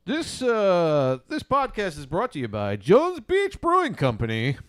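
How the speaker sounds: loud announcer ad voice; ad read. Shown as a demonstration. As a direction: sell the beer like a radio spot. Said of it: "the other would be here going".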